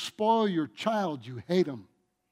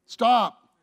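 loud, higher, one more weary stop; nagging stop; 6 semitones higher, 8 dB louder than usual. Retold or imitated. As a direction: yet another useless command. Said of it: "Getting tired of that yet?".

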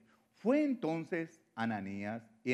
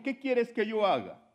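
higher and louder, a cry to the Lord; urgent loud plea; 8 semitones higher, 5 dB louder than usual. Retold or imitated. Preacher voicing Saulo crying out to Jesus, loudly. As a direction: call the question out with urgency. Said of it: "Él dijo".

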